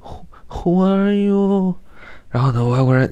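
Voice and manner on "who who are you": very high, very slow coy voice; high, slow, coy "Who, who are you"; Lin Daiyu just woken. Retold or imitated. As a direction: play a languid, just-woken delicate beauty. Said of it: "就是林黛玉".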